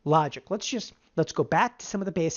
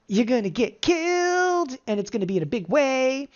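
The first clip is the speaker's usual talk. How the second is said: dramatic warning; 7 semitones higher than usual. Real, not acted. Trading teacher warns audience about the other ten percent, dramatic warning. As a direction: stretch it out high for dramatic effect.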